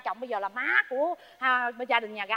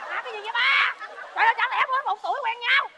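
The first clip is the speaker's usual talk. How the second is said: incredulous; 7 semitones higher than usual. Acted. Disbelief at the gossip.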